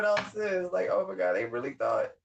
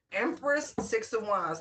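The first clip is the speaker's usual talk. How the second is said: ordinary delivery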